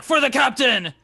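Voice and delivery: put-on loud battle cry in a Russian accent; Russian-accented shout. The guard yells back to the player, loud Russian-accented cry. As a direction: answer back like a Russian soldier. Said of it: "but in a Russian accent".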